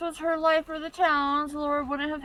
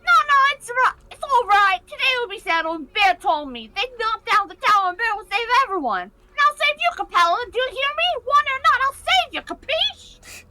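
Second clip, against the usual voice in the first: put-on higher, eager little kid voice; a small boy promising bravely to save her; determined child Sticky; 9 semitones higher, 7 dB louder, faster than usual.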